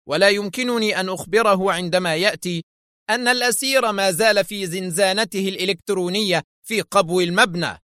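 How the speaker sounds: high-pitched flustered explanation; nervous. A narrator's reading. Director at a loss over the envoy, nervous. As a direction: explain your bind with nervous agitation. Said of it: "وقلب كفيه قائلا في عصبية".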